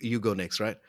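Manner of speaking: usual speaking voice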